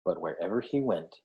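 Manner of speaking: ordinary delivery, nothing marked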